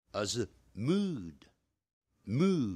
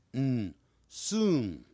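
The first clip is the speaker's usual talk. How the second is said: ordinary delivery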